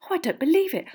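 put-on high, flustered girlish wail; flustered dismay in a high girl's voice; frantic fangirl. A narrator's reading. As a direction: fret like an excited schoolgirl. Girls frantically searching their pockets.

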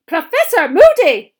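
slow, high, very loud cry; shocked. A narrator's reading. Colleague discovering the bouncing ferret, shocked. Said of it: "said a shocked voice".

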